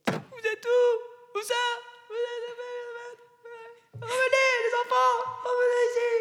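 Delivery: long, high searching shouts; calling out. Acted. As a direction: call into the distance for missing children. Mother calling her missing children.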